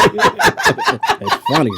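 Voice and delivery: high, drawn-out laugh through the words. Real, not acted. Co-host laughing hard at the pun. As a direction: crack up mid-line. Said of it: "laughing take".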